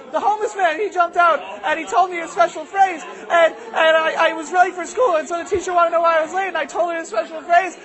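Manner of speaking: put-on high, strained run-on kid voice; upset child. Boy recounts his ordeal to mom, upset. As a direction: pour it all out like a distressed boy.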